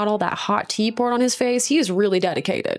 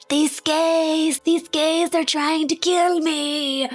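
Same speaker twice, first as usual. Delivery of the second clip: higher, slow, wailing plea; panicked bride on the phone; 8 semitones higher, much slower than usual. Retold or imitated. Grace calling for help, higher slow panicked plea. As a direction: beg the police like she's being hunted. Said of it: "she's like".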